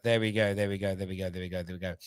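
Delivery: low, soft repeats trailing away; fading repetition. Real, not acted. Host winding down the banter, fading out.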